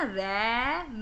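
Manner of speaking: रै with the vowel held long; long sound. Shown as a demonstration. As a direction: stretch the "ae" in रै to show the long sound. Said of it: "तो long sound है ना".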